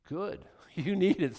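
ordinary delivery

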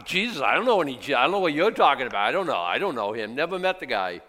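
loud and fast flustered denial; panicked denial. Acted. Peter denying Jesus, loud, fast and panicked. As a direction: gabble it like a man caught out.

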